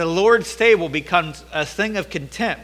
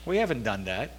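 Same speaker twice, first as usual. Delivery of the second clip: much lower, softer sulky denial; low mumbled denial; 8 semitones lower, 10 dB quieter than usual. Retold or imitated. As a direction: mumble it low like a guilty sulk. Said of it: "they're saying".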